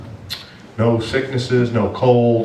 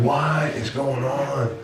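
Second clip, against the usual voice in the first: baffled; 5 semitones higher, 5 dB quieter, much slower than usual. Retold or imitated. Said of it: "I'm like".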